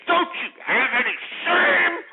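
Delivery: high, drawn-out screaming demand; outraged shout. Real, not acted. Host shaming the welfare caller, outraged shout. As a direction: shout it out, stretching the last word.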